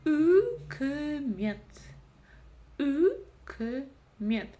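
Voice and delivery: "Үкімет" in separate parts, loud opening Ү; slow emphatic sounding-out of "Үкімет"; syllable by syllable. Shown as a demonstration. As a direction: hold the first vowel of "Үкімет", then pause between syllables. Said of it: "нужно разделить по слогам".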